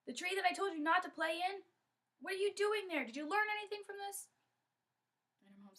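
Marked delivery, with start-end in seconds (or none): 0.1-4.3 high, loud, outraged questioning; angry scolding. Acted. Father to Kondoma about the forbidden tree, angry scolding. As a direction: scold a disobedient child.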